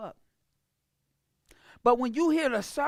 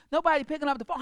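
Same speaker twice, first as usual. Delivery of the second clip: usual speaking voice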